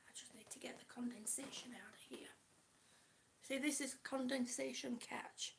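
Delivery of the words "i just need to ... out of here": whispered aside. Real, not acted.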